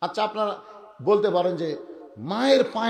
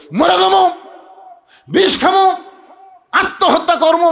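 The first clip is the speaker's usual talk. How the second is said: high, loud labour-pain wail; cry of agony; 11 semitones higher, 10 dB louder than usual. Acted. Preacher acting a woman in labour, agonised yelling. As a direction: yell it out in bursts, acted. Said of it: "তখন কষ্টের চোটে বলে".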